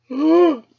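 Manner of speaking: a sudden loud startled gasp; high sharp intake of breath. Acted. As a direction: gasp in shock. Storyteller gasps at snake memory.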